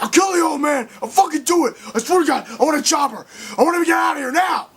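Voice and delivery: high, loud frantic yelling, speeding up at the end; a cornered man screaming demands; panicked hostage-taker shouting; desperate threats. Retold or imitated. Cornered villain making threats.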